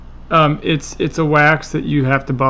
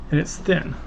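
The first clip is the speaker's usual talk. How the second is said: normal talking voice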